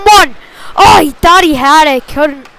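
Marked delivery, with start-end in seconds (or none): none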